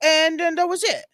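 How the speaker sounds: high excited run-on imitation of Karen Reed; Karen Reed impression. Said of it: "she's just like".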